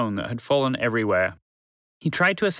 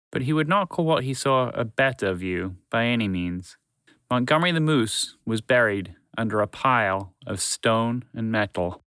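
plain reading voice

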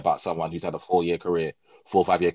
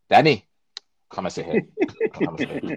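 manager calling player off; 9 semitones higher than usual. Retold or imitated. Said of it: "made the manager say".

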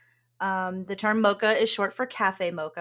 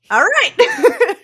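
high loud all right; excited; 13 semitones higher, 11 dB louder than usual. Real, not acted. After hanging up, excited.

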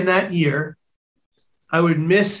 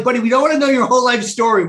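quick, higher-pitched interruption; mildly exasperated officer; 4 semitones higher, 3 dB louder, faster than usual. Retold or imitated. Imitating police officer to over-excited stranger, mildly exasperated. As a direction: lightly imitate an officer cutting off a gusher. Police cut off his gushing.

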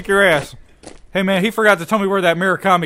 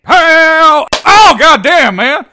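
loud pained yell after striking the board; 10 semitones higher, 12 dB louder, much slower than usual. Acted. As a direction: yell out as the hand throbs. Hurting hand after hitting board.

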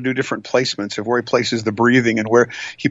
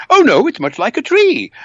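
much higher, louder character voice; contradicting blind man; 12 semitones higher, 5 dB louder than usual. Acted. Second blind man disputing the elephant, high loud protest. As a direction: object loudly in a high put-on voice.

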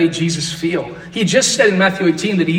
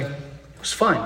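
"It was fine" said much softer, "fine" trailing off; soft deflated "fine"; 7 dB quieter, slower than usual. Shown as a demonstration. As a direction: let "fine" fade out, a bit let down. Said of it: "It's different, right?".

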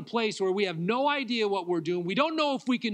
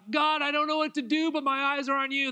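higher, louder crying out to God; fervent cry; 9 semitones higher, 4 dB louder than usual. Retold or imitated. Pastors on the prayer room floor crying out, fervent. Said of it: "we still lay on the floor and go".

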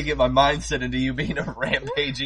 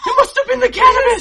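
high, fast, laughing mock shriek with an Australian accent; shrieking Australian farmer; 5 dB louder, faster than usual. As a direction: screech it in panic, Aussie style. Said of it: "you're gonna be an Australian sheep farmer".

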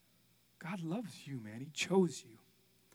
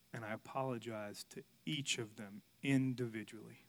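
ordinary delivery

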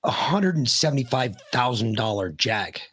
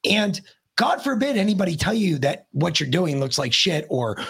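usual speaking voice

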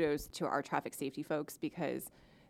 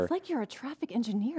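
playfully impressed and high; teasing; 8 semitones higher than usual. Real, not acted. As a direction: tease him in a high voice. Teasing the host.

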